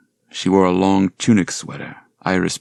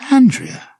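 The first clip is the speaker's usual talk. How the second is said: surprised; 8 dB louder than usual. Acted. Surprised to see visitor.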